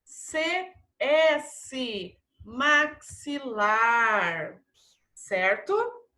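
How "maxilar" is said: "Maxilar" hugely drawn out, mak-si-laar. Shown as a demonstration. Teacher confirming CS to pupils, "Maxilar" in long syllables. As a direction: dictate "Maxilar" very slowly with clear ks. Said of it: "Tem som de C S".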